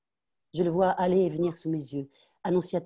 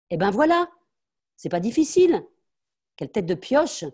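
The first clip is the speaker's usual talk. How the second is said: much higher, loud and scornful; scornful triumph; 6 semitones higher, 7 dB louder than usual. Acted. Mother mocking her stubborn daughter, much higher and loud. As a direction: rub in the victory.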